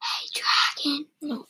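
hushed whisper into an ear; soft whisper. A narrator's reading. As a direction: whisper it softly, right up close. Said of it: "whispered very softly".

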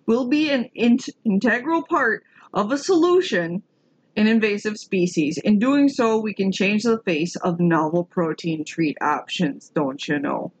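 exaggerated Minnesotan accent, dropping at the end. Finishing the Minnesotan founder's quote, put-on Minnesotan accent. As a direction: lay on a folksy Minnesotan lilt. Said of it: "so I gotta do the accent".

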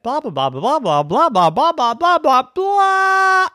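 from plain babble up to a high loud shout; building to a shout. A narrator's reading. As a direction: rev the blahs up until they burst out. Endless inner chatter, building.

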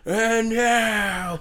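high imitated ring announcer intro. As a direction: mimic a boxing ring introduction. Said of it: "as I was like".

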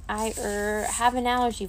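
stall, then land on a made-up excuse; improvised excuse. A narrator's reading. Boy inventing an allergy for his friend, hesitant. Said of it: "thinking quickly".

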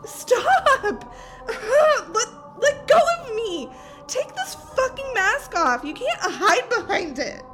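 crying out while fighting free; tearful, frantic demands; panicked struggle. Acted. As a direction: sob and yell to be released. Struggling against attacker.

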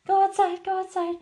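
very high, sing-song reassurance to a scared dog; high soothing baby talk. Retold or imitated. Owner coos at a frightened peeing puppy, very high sing-song.

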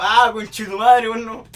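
loud, drawn-out wail of frustration; exasperated retold cry. Kid who missed it all, exasperated outburst. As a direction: curse it out long and loud. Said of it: "Y yo estaba como".

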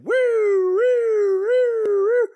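very high, long woo sounds; high sing-song woos to break her crying; high silly woo. Retold or imitated. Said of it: "I'm like".

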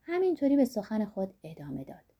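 plain reading voice

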